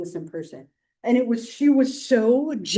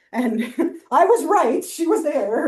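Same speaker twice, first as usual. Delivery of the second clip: laughing; 6 semitones higher, 7 dB louder, slower than usual. Real, not acted.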